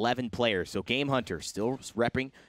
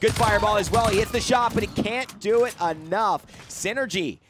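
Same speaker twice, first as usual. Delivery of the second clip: loud, high hype play-by-play; esports caster; 11 semitones higher, 7 dB louder than usual. Real, not acted. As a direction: call the play at full volume. Live play-by-play.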